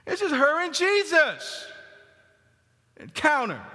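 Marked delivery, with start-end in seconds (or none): 0.1-1.7 high, projected emphatic repeat. Real, not acted. Preacher repeating the point to the congregation, emphatic. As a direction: lift the pitch and drive it home. Louder repeat of hushed line.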